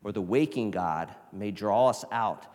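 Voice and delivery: loud, fervent reading of the quote; dramatic reading. Shown as a demonstration. Preacher reading Annie Dillard to the church, fervent and loud, ending in a whisper. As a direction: read it like a warning to the church. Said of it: "She says".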